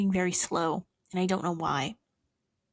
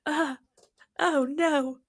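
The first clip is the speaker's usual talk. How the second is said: high mock dread; 6 semitones higher, slower than usual. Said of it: "And I was like".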